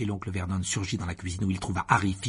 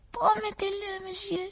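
high squeaky elf voice; shrill little creature, insistent; house-elf voice. Acted. Dobby insists.

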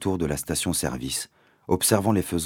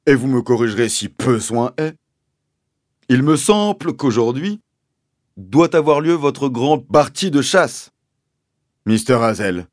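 higher, loud, pompous sergeant; police sergeant swapping P and B; 8 semitones higher, 8 dB louder than usual. A narrator's reading. Sergeant questioning the landowner's accusation, pompous, P and B swapped. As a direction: pronounce every P as B, with official ponderousness. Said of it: "remplacer les P par des B".

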